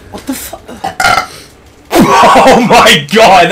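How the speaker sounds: slow, loud words through laughter; laughing. Real, not acted. Laughing at a burp.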